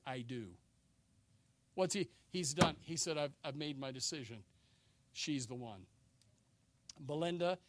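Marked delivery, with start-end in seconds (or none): 0.1-0.5 low, softly spoken wedding vow; grave, understated pledge; solemn vow. Acted. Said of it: "emphatically, he stands before people". 3.2-5.9 low, soft declaration of a settled choice; quiet resolve. Acted. Groom declaring his final decision.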